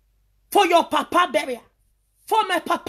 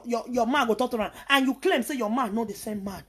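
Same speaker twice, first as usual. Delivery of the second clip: normal talking voice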